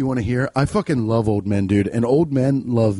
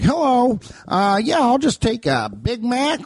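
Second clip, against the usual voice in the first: put-on high, drawn-out old man; 10 semitones higher than usual. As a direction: play an elderly customer ordering, pitched up. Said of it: "That's an old man voice".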